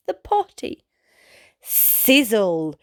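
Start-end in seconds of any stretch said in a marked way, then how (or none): none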